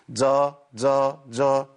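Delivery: جا drawn out, slow, with breath; wrong breathy Daad. Shown as a demonstration. Teacher imitates the common mistake. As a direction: stretch جا slowly. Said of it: "تبقى إيه؟".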